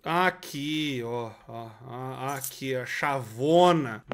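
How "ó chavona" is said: ordinary talk, nothing marked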